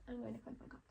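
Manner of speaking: unvoiced whisper. Real, not acted. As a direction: mutter it privately. Whispered aside to herself.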